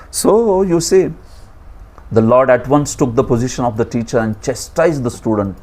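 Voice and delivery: normal talking voice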